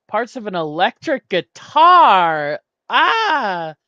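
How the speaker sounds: loud, high cry of triumph; real excited shout. Real, not acted. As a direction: shout the answer, then a long high "Ah!". Solves last puzzle category, cries out.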